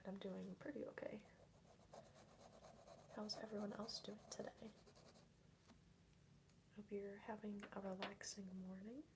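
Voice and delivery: hushed ASMR whisper; breathy whisper for the mic; soft whisper. Real, not acted. Soft ASMR stream chat.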